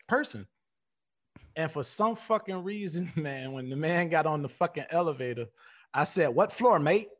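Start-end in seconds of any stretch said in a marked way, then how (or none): 6.3-7.1 put-on slipping into a British accent; casual British lift-man voice. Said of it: "I had the whole accent and all".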